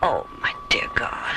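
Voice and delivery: a horrified whisper under the breath; shocked whisper. Acted. Doctor shocked at blindness.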